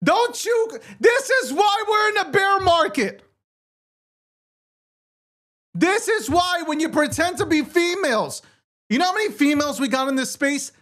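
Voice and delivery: high-pitched, worked-up comic tirade; exasperated rant. Real, not acted. Host complaining about men posing as women, exasperated rant.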